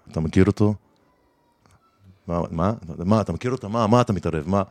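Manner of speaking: imitating the friend who steps in; menacing stranger. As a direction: confront him low and suspicious.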